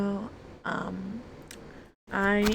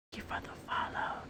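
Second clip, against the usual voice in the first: fading whisper; 10 dB quieter, much faster than usual. Acted.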